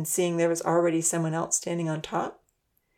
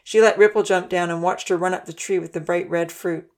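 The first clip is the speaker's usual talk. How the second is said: ordinary delivery, nothing marked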